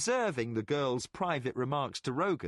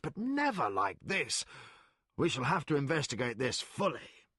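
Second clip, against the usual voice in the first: amazed Colgan. Acted. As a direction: savour the wonder.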